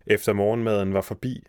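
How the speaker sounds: ordinary delivery, nothing marked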